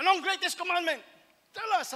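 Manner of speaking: calling out the question from the crowd; asking the question loudly. Retold or imitated. Questioner voiced in sermon.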